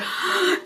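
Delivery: sharp, soft intake of breath; mock triggered gasp. Newly triggered meditator reacting, mock gasp.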